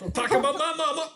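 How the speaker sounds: high, loud, quick mock outburst; hammed-up threat. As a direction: blow up in comic outrage, voice shooting up. Escalated comic repeat of threat.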